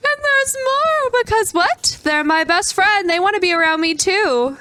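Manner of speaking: high excited gushing; delighted gasp. Real, not acted. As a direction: gush over pets that love him. Delighted pets follow him.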